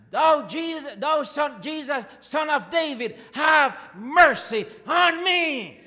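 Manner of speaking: loud pleading cry, the last line slow and drawn out; crying out for mercy. Retold or imitated. Blind beggar calling out to Jesus, loud pleading cry. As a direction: beg for mercy at the top of your voice. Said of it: "And he started to cry".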